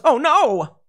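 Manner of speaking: high, hammed-up scared yelp; mock fright. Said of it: "And he goes".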